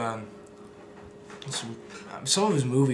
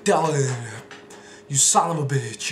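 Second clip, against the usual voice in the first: louder, hearty Arnold greeting; Arnold impression; 6 dB louder than usual. Arnold greeting his CIA friend loudly. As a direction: greet like Schwarzenegger. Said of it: "those are my Arnold impressions".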